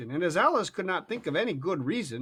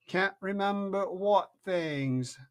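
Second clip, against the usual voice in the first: slow, superior question; caterpillar voice; 5 semitones higher, much slower than usual. A narrator's reading. Caterpillar quizzing Alice, slow and lordly. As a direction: drag it out, unimpressed.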